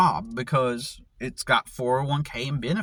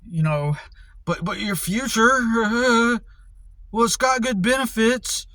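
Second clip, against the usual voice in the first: high, laughing mock of the people; mocking whiny voice; 8 semitones higher, 4 dB louder than usual. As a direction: parody them, high and snide with a laugh. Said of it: "they'll be like".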